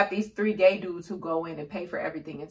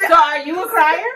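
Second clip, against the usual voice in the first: loud teasing; 8 semitones higher, 8 dB louder than usual. Real, not acted.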